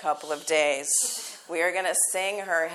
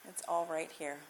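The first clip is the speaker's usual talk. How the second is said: guided meditation; 3 semitones lower, 12 dB quieter than usual. Acted. Teacher guiding the class into final rest, soft meditation voice. Closing relaxation cues.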